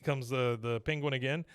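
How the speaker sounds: usual speaking voice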